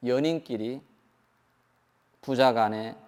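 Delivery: usual speaking voice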